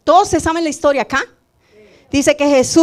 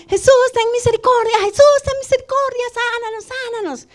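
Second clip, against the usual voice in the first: leper pleading for mercy; 9 semitones higher than usual. Retold or imitated. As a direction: beg Jesus for healing, then let the voice sink. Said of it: "Decían". (súplica aguda de leproso que se apaga al final)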